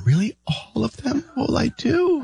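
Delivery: high-pitched mock patient squeak; retold dental patient. Patient hears dentist's verdict on the rot, high mock dismay. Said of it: "I can hear the dentist talking to a patient".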